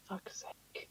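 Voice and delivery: muttered under breath, unvoiced; mock dentist whispering a curse; whispered curse. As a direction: hiss it under your breath. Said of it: "he just went".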